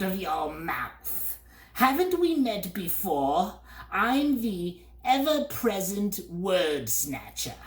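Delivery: high, slow boastful bird voice; smug word snatcher bird. A narrator's reading. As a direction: brag like a smug little pest. Bird figure.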